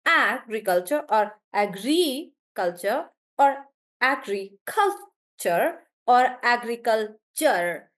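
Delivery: agriculture stressed on a different syllable each time; shifting stress. Shown as a demonstration. Teacher trying out stresses aloud, shifting stress through agriculture. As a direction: try agriculture slowly, punching AG, then GRI, then CUL, then TURE. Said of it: "put the stress on different syllables".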